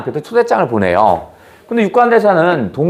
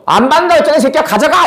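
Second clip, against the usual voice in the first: mock angry refusal; 11 semitones higher, faster than usual. Student mock-yelling at an elder offering money. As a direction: shove the money back in mock rage.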